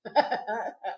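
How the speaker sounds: soft, low little giggle. Acted. Nurse pretending to order a shot, giggling. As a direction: chuckle quietly to yourself.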